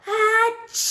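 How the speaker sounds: big high sneeze; loud sneeze. Acted. The bear sneezing from a pepper flake, loud. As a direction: explode into a sneeze. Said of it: "makes the bear go".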